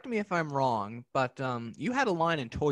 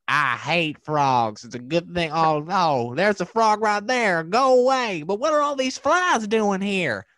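higher, louder cartoon grump, slow and fussy; cranky frog-hating man; 6 semitones higher, 7 dB louder, slower than usual. Retelling a Sesame Street cartoon, a frog-hating man in a loud, high cartoon voice. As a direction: act out the grumpy cartoon man ranting at frogs. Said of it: "And the man's like".

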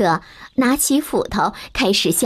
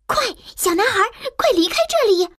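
higher, very fast urging; urgent maid; 12 semitones higher, much faster than usual. A narrator's reading. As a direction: send the boy away quickly. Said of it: "佣人告诉他". (急促的女佣)

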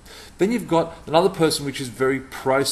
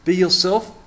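louder, higher, doubting rise on yourself; sceptical question; 6 semitones higher, 6 dB louder than usual. Shown as a demonstration. Speaker doubting the old saying, louder and rising. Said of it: "Not so sure".

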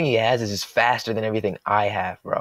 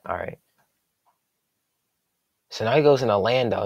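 normal talking voice

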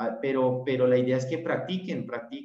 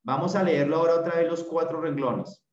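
ordinary delivery

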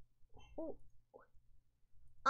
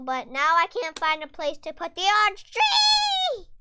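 louder, higher whine about the tree; loud whiny complaint; 4 semitones higher, 10 dB louder than usual. Real, not acted. Gamer complaining to viewers she can't place the tree, loud whine. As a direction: wail it in mock despair. Frustrated about finding a spot.

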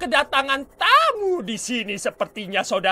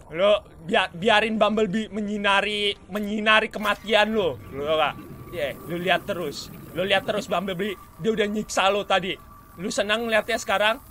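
usual speaking voice

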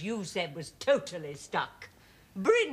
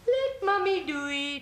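higher, cooing mummy voice; doting baby talk; 8 semitones higher than usual. Acted. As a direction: fuss over him like a toddler. Mother fussing over son's chin.

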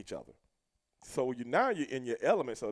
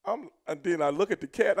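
usual speaking voice

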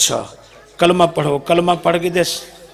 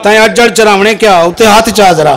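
loud, raised call to the crowd; 6 semitones higher, 6 dB louder than usual. Real, not acted. Preacher challenging listeners to respond, loudly calling out. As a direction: work the audience at high volume. Calls out to the audience.

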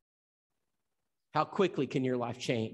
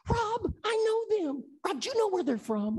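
astonished church lady. Retold or imitated. Deacon's wife voiced, astonished.

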